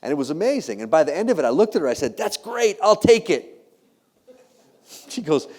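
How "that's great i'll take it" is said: higher-pitched, eager yes; retold eager acceptance. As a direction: jump at the offer, pitch lifted.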